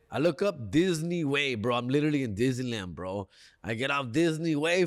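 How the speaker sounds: normal talking voice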